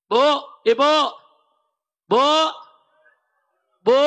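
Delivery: loud, short cries to the crowd; rakyat berteriak. Acted. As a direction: call out like a protesting citizen. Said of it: "Kalau rakyat teriak". (teriakan rakyat)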